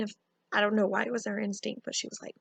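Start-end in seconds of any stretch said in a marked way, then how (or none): none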